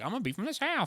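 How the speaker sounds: high-pitched Southern caricature; mock someone's panicked Southern accent. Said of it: "It's your go-to accent".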